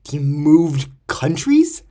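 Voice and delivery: "what do you mean he moved countries?" built up loud; shocked disbelief. Retold or imitated. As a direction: cry out in stunned disbelief at the news.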